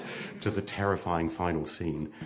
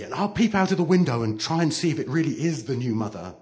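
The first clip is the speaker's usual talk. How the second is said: ordinary delivery, nothing marked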